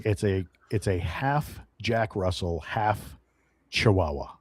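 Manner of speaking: ordinary talk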